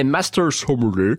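sommelier in a mock English accent, low; cheap English accent. Shown as a demonstration. Presenter showing off the title, cheap English accent. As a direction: put on a tuppenny English accent. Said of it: "je le prononce avec cet accent, euh, anglais".

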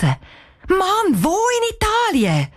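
very high exasperated acted cry; calling out loudly. A narrator's reading. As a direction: call out the question, pitch soaring. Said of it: "Cheyenne ruft laut raus".

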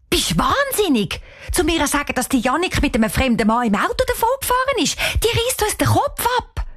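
high, agitated outburst; aghast. Acted. Cheyenne refusing to wake the aunt, aghast. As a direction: protest in alarm, climbing to a high finish. Rejects waking the aunt, alarmed.

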